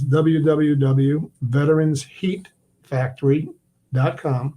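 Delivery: slow and deliberate, word by word; careful slow read of the web address; slow dictation. Real, not acted. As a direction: spell it out so listeners can write it down. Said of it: "spelling out website slowly".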